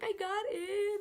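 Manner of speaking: very high tearful squeak; mock-weepy wail; retold crying. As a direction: imitate sobbing out good news. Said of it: "I was still crying".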